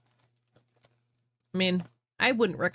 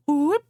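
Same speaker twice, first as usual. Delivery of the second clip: high loud sung whoop; mock opera whoop; 9 semitones higher, 6 dB louder than usual. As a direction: belt a silly operatic whoop. Mimicking opera singing sound.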